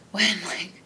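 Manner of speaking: whispered disbelief, breathy and unvoiced; incredulous whisper. Whispering back to the manager in disbelief. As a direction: hiss it in disbelief.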